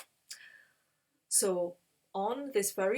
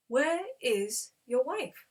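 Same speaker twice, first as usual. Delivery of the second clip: slow, higher, deliberate question; solemn questioning; 4 semitones higher, slower than usual. Retold or imitated. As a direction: voice a mysterious visitor asking pointedly. Visitors solemnly question Abraham.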